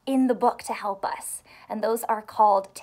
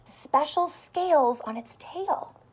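ordinary delivery

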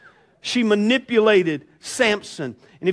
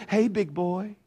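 seductive Delilah; 7 dB quieter than usual. Pretend. Preacher voicing Delilah luring Samson, soft seductive tease. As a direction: purr it like a temptress luring a strongman. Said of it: "Do I need to do it in that voice".

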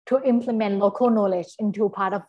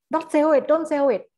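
higher and louder, mock pleading command; hammed-up insistence, higher and louder; mock insistent order; 6 semitones higher, 4 dB louder than usual. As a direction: repeat it like a pushy official telling owners not to sell. Voicing a demand to property owners.

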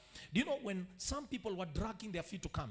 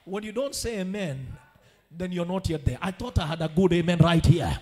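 loud call for amen; 12 dB louder than usual. Acted. Calls for amen.

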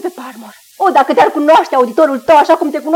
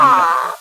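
wailing sob of distress; anguished cry; 12 semitones lower, 3 dB quieter than usual. Acted. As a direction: cry out, wounded. Daughter breaks down in quarrel. (geamăt plâns, îndurerat)

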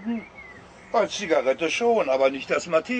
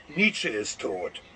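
much lower, slow, booming voice of the Almighty; voice of God; 7 semitones lower, 3 dB quieter, slower than usual. Acted. The Almighty has the last word, slow deep godly verdict. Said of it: "Er sagt".

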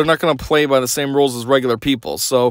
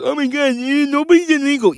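high-pitched mocking whine; mock outrage; 11 semitones higher than usual. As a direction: imitate an outraged objector in a silly high voice. Mockingly quotes outraged Democrats.

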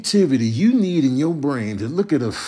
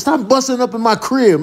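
mock scolding; 9 semitones higher, 4 dB louder than usual. Real, not acted. Yelling at bugs.

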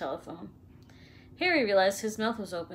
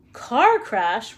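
roared out in outrage; 10 dB louder than usual. A narrator's reading. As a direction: bellow it back at them. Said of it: "roared Hagrid".

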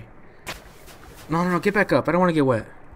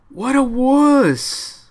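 slow, stretched-out mockery; sneering at the scaredy-cat; mocking drawn-out taunt; 8 semitones higher, much slower than usual. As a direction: drag it out to rub it in. Mocking a character's fear.

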